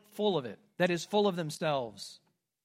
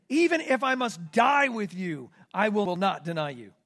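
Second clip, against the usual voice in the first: louder, emphatic promise; Peter's vow; 7 dB louder than usual. Acted. Peter pledging to Jesus, loud emphatic vow. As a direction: swear it to the death. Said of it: "Peter said to him".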